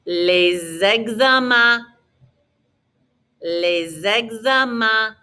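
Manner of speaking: LES EXAMENS slowly as lay-zeg-za-man; teacher's slow model of LES EXAMENS; Z liaison. Shown as a demonstration. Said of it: "we put a little Z sound in there".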